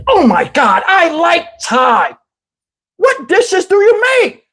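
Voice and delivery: gushing, high and drawn-out mock lady voice; irritating woman. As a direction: gush slowly in an annoying high voice. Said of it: "voice to people that irritate me".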